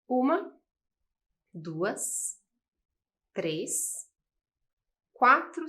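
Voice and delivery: slow count of the syllables. Shown as a demonstration. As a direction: count each one with a pause. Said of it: "Vamos contar ali?".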